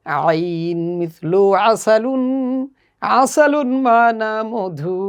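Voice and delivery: আসালুন said slowly with throaty আইন; slow Arabic model reading. Shown as a demonstration. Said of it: "আসুন আমরা একসাথে পড়ি". (আসালুন ধীরে গলা থেকে আইন দিয়ে)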